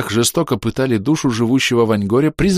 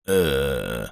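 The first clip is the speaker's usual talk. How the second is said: much lower, softer dazed uhh; stunned low drawn-out er; 7 semitones lower, 5 dB quieter than usual. Acted. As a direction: squeeze out a stunned noise. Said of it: "выдавил призрак".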